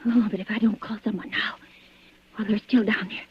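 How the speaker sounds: frightened low whisper; hushed, urgent self-talk. Acted. Terrified woman whispering her fears alone.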